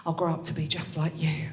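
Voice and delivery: hushed, breathy adoring whisper; awed whisper. Retold or imitated. Schoolgirl to her teacher, an awed whisper. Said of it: "I was like".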